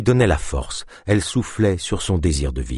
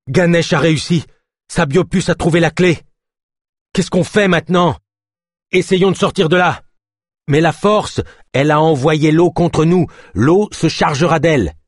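put-on high, louder urgent girl voice; young woman, urgent; 10 semitones higher, 5 dB louder than usual. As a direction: speak fast with worry.

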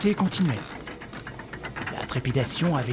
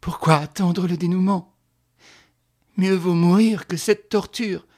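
raised, louder, anguished urging; desperate to end it; 5 semitones higher, 6 dB louder than usual. Acted. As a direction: press for an end, voice lifted. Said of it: "dans un besoin subit d'en finir".

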